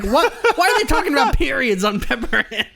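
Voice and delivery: high-pitched baffled questioning through laughter; amused mock disbelief, voice way up; incredulous mom. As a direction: imitate a flabbergasted parent. Retelling mom's baffled reaction.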